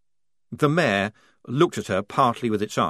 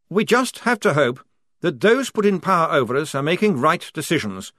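plain reading voice

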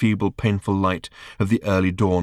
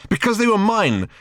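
higher, louder indignant retort; crossly; 13 semitones higher, 3 dB louder than usual. A narrator's reading. Ford defending his shoes to Arthur, cross. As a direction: snap it back, offended. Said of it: "said Ford crossly".

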